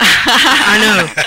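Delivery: loud laughing agreement. Real, not acted. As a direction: laugh out the words. Reacting to the Irish joke.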